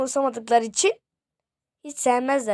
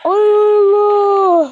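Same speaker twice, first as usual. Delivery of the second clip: loud cry; 7 semitones higher, 10 dB louder than usual. Real, not acted. Startled in game.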